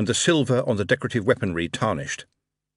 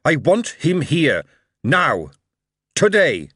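loud, high, slow commands; louder and louder; 8 semitones higher, 5 dB louder than usual. A narrator's reading. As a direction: hammer each demand home. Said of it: "the voice of the king was even louder".

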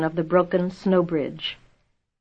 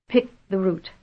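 understated gasp, spent and brief; a touch out of breath; 3 dB quieter than usual. A narrator's reading. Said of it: "he gasped".

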